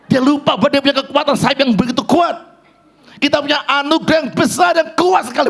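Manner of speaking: high, impassioned preaching; preaching at full force. Acted. Preacher exhorting the congregation, impassioned and high. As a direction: rouse the congregation from the pulpit.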